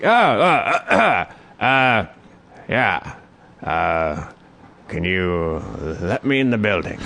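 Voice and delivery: mumbling homeless man. Retold or imitated.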